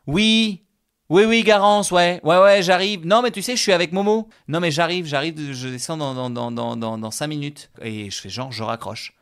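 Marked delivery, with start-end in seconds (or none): 0.1-7.7 high, loud pretend chat with Garance; loud staged phone talk, settling down at the end; fake phone call. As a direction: act busy to escape Momo.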